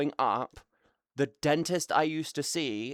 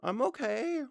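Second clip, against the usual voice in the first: high, far-off cute voice; 10 semitones higher than usual. Pretend. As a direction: answer like a tiny sweet creature. Answering chat in a cute voice.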